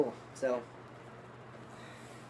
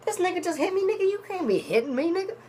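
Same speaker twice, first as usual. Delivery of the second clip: very high-pitched imitation of outraged complaining; indignant mock protest. Eugene on the floor after being socked, imitated in high-pitched outrage.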